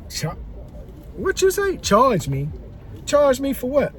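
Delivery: incredulous disbelief. Retold or imitated. Retelling the bar girl's ice charge, mock incredulous. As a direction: voice jumps up in shocked disbelief. Retold outrage at being charged.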